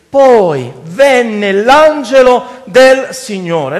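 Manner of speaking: very slow, stretched-out verse reading; solemn scripture quotation. Acted. Preacher reading verse eleven to the congregation, solemn quotation. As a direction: dwell on each word of the verse. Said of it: "che dice così".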